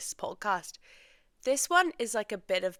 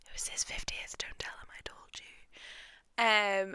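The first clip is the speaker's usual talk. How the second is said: hushed, unvoiced aside to the listener; conspiratorial whisper. Real, not acted. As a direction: whisper it like a secret you shouldn't share. Secret aside about dad's birthday.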